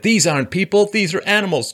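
high, loud mock quote of the line; quoting Trump. Quoting a politician to viewers, in a mocking high voice. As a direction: read it as a shrill put-on. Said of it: "He said".

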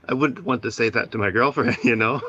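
nervous chuckle breaking into the words; starting to chuckle. Real, not acted. Caller deflecting a painful memory, starting to chuckle. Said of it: "you were starting to chuckle".

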